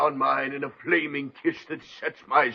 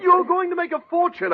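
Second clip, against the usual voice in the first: excited; 3 dB louder than usual. Acted. Publisher pushes author toward fortune.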